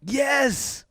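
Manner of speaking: high, emphatic yes; thrilled high exclamation; excited agreement. Real, not acted. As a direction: burst out in delighted recognition. Excited agreement about robot.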